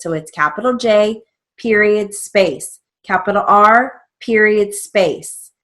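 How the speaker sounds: "period" and "space" said slowly and firmly; each "period" and "space" stressed, one at a time; dictating. Shown as a demonstration. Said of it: "very important that you have a space".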